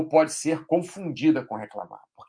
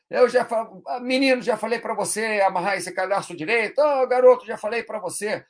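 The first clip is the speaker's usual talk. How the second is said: exasperated parent scolding; mock scolding parent; 6 semitones higher than usual. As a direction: nag the kid hard. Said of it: "O cara pode tá brigando".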